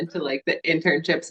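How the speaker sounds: normal talking voice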